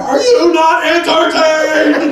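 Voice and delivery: slow, loud, high triumphant roar; Gladiator challenge. Retold or imitated. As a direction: bellow it to the crowd like a gladiator. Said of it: "turns to the neighborhood like Gladiator".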